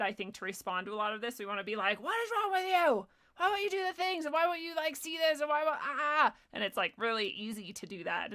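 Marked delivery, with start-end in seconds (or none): 2.0-6.4 high-pitched, mock outraged scolding; exasperated rant. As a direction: rant at them till words give out. Acting out the harsh response.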